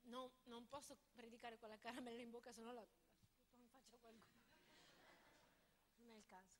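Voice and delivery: mumbled off-mic aside; quiet aside. Real, not acted. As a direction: drop away and mutter to yourself. Aside while coughing.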